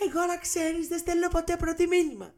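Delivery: put-on girl calling out as he leaves, high and indignant; girl calling after. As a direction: shout after him in a high prissy voice. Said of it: "Και φώναζε".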